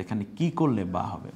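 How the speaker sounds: usual speaking voice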